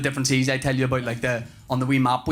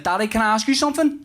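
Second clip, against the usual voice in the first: put-on higher, quick child's question; imitated young son; 7 semitones higher, faster than usual. Three-year-old asks dad in the car, imitated child. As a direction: put on a little boy's asking voice.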